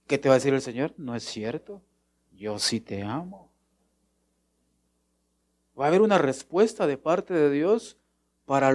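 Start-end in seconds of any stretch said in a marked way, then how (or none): 1.0-3.5 much softer and lower, loving; whisper-close comfort; tender reassurance. Retold or imitated. God telling a sad believer he loves them, soft and tender.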